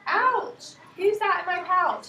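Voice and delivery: teacher voicing the kangaroo for the class; startled kangaroo. A narrator's reading. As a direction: yelp it like something poked your pouch. Kangaroo voice in picture book.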